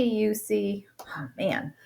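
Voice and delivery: hushed stage whisper; a soft conspiratorial whisper. Acted. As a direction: lean in and breathe it. Whispered aside to listener.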